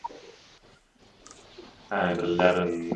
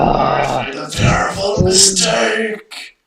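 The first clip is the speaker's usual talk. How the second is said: dramatic robot voice; 10 semitones higher, 8 dB louder, slower than usual. Acted. Player voicing centurion's regret.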